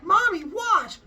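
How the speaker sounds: put-on high, loud child shout to mom; excited kid call. Kid calling mom to watch, high excited shout. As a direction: yell it like a thrilled kid.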